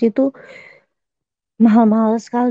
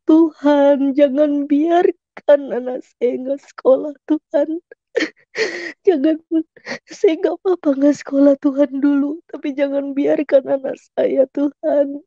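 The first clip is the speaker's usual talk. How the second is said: pleading to God, voice cracking, higher and slowed; tearful prayer; 5 semitones higher than usual. Real, not acted. Mother prays her son can go to school, weeping. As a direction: beg God through real tears.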